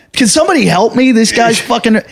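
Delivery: high-pitched exasperated appeal, retold; indignant high plea; pleading for help.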